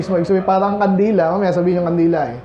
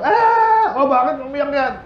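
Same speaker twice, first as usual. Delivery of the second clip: very high fake wail, then a mock scold; a put-on tattletale wail; mock crying child; 6 semitones higher, slower than usual. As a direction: ham up the fake cry. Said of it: "umiiyak ng".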